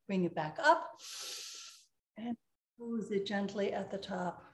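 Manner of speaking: soft, calm breathing guidance; guided breathing. Acted.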